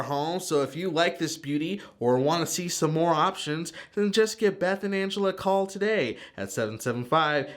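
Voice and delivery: upbeat sponsor spot read over music. Acted. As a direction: sell it like a friendly hometown commercial. Reads sponsor ads for the show.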